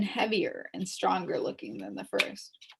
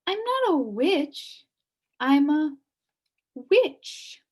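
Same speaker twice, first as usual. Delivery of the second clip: high put-on old lady voice, slow; cheerful old witch; 7 semitones higher, 5 dB louder, slower than usual. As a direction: reassure the frightened boy. Witch figure explains.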